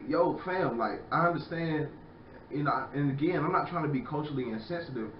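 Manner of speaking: normal talking voice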